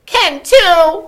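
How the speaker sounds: put-on very high, loud childish cry; crying little brother. A narrator's reading. Little brother crying back at Sophie, very high and loud. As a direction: wail it back like a toddler. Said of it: "he cried".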